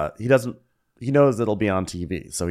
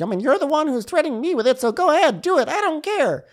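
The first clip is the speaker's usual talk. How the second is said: much higher, fast defiant voice; mock Eddie; 4 dB louder than usual. Eddie calling his girlfriend's bluff, high defiant mockery. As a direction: sneer it out high and quick.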